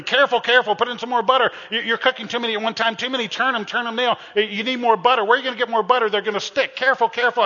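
high, loud frantic yelling at the cook; mock screaming husband. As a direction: screech the cooking orders like a panicked backseat driver. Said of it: "He started screaming".

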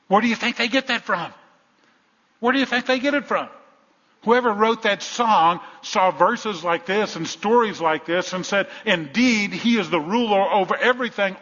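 high, loud, driving sermon delivery; full-force preaching. Real, not acted. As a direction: preach it out with rising insistence.

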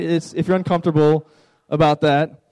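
usual speaking voice

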